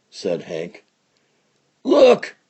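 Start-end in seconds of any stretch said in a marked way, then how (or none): 1.8-2.4 high, sudden yelled alert. Acted. Outlaw spots the cattle stampede coming, high yell. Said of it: "yelled Skunk Skeeter".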